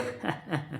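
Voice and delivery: soft, mischievous giggling; light teasing laugh; soft giggle. Acted. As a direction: giggle quietly at your own joke. Child giggles about the vegetable.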